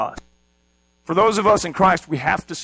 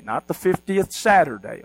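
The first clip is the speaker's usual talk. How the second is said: ordinary talk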